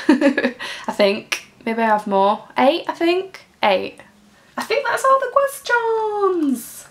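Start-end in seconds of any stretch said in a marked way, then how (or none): 4.6-6.9 high, slow, drawn-out announcement; singsong wrap-up. Real, not acted. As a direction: stretch it out high in triumph. Celebrates reaching the end.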